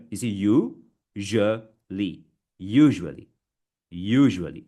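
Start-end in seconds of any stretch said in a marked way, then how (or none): 0.3-2.2 "u, je, li" as separate beats; syllable-by-syllable. Shown as a demonstration. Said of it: "Break it, guys". 2.6-4.6 USUALLY louder, as YOO-zhuh-lee; careful model pronunciation. Shown as a demonstration. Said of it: "It's not usually".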